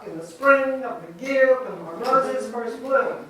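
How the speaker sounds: in the SPRING of the YEAR when the ROses first BLOOM; anapestic stress. Shown as a demonstration. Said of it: "da, this one is da".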